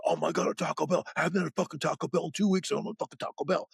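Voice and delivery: high, hammed-up rave over Taco Bell; mock frantic kids. Campers mocked raving over a Taco Bell, hammed-up excitement. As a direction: mimic teens going nuts for fast food. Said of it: "the Long Island kids went fucking nuts".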